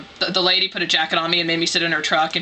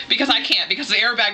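usual speaking voice

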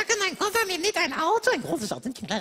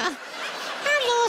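drawn-out "Hallo" as if to someone deaf; calling out mockingly; 6 semitones higher than usual. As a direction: call out teasingly to a deaf person. Mocking deaf old person.

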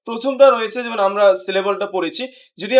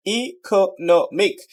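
syllable by syllable; much slower than usual. Shown as a demonstration. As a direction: slowly split "economic" as ই, কো, নো, মিক. Teacher breaks word into syllables. ("Economic" ধীরে ভেঙে ভেঙে)

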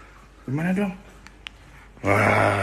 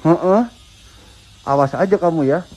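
usual speaking voice

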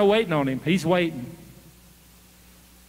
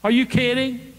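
acted disbelief, pitched up; higher-pitched incredulous retort; 6 semitones higher than usual. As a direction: question it in mock disbelief. Acted king doubting the boy.

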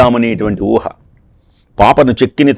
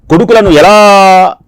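higher, louder, slow cry of grief; a mother's lament breaking out; anguished plea; 6 semitones higher, 4 dB louder, slower than usual. Acted. Devaki laments her killed sons. (దుఃఖంతో బిగ్గరగా విలపిస్తూ)

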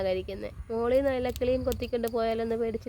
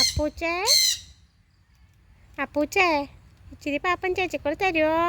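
lightly sweetened coaxing; coaxing pet talk; 5 semitones higher than usual. Real, not acted. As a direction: coax the pet gently.

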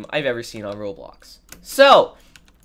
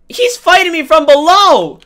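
high, loud panicked call-out; alarmed shout; 13 semitones higher, 12 dB louder than usual. Real, not acted. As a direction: yell it as you're ambushed. Alarmed call-out during a fight.

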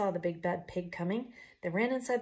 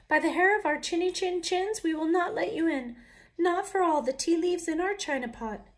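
high, loud, defiant chant; little wolves' voice; 10 semitones higher, 7 dB louder than usual. Acted. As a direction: stand firm in tiny high voices. Wolves refusing the pig.

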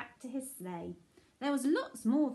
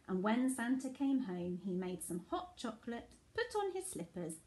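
ordinary delivery, nothing marked

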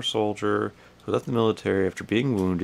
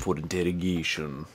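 put-on a half-hearted try at the inspector's British accent, slightly lower and softer; copying his accent; 3 semitones lower, 4 dB quieter than usual. Player echoing the game inspector in a mock British accent. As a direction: echo the line in a failed British accent. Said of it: "That's not his accent".

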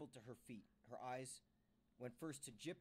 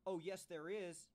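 louder, higher, slower insistence; insistent outburst; 8 semitones higher, 9 dB louder, slower than usual. A narrator's reading. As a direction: cut in firmly to contradict. Carol breaks in, insisting.

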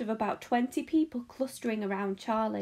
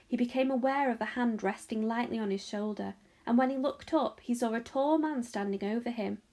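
ordinary delivery

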